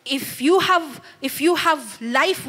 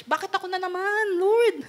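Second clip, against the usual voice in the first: mock whine, higher and faster; whining churchgoer; 6 semitones higher, 3 dB quieter than usual. Preacher mimicking a member complaining to God, mock whining. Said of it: "Nakakarinig ba kayo dyan".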